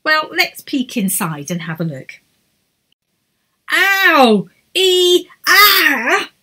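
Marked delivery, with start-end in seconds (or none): 3.7-6.3 pained yelps. A narrator's reading. Spiking in tent.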